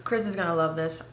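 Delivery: ordinary delivery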